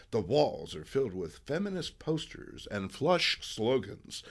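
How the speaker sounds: normal talking voice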